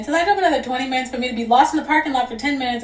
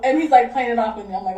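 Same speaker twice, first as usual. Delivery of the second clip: ordinary talk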